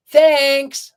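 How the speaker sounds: high, loud honk of thanks. A narrator's reading. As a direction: honk it out happily. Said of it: "honked the little old car".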